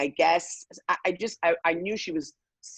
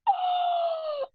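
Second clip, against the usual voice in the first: very high, long-held thin "oh"; drawn-out mock squeal of shock; imitated shocked crowd. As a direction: mimic a whole room's horrified cry at a high pitch. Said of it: "The whole dining room again is like".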